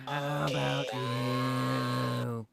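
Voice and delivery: very slow, raspy smoker's voice; chainsmoker voice. Shown as a demonstration. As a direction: drag it out like a lifelong smoker. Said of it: "And slightly increase the volume".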